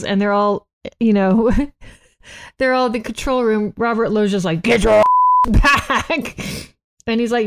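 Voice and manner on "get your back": drawn-out mock yell of an order; retold shout. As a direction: imitate a man yelling across a control room. Retold yell from control room.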